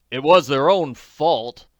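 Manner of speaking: loud, slow declaring; emphatic. Acted. Bystander blames drivers, emphatic declaration. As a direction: declare it firmly, slowly. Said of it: "declared emphatically".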